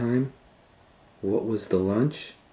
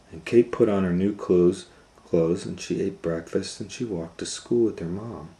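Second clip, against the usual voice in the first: ordinary delivery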